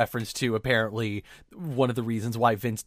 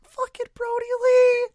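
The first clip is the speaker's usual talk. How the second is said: much higher, slow, drawn-out cheer; hyped shout; 5 dB louder than usual. Retold or imitated. Co-host cheering the wrestler's reveal, hyped. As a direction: stretch it out like a thrilled fan.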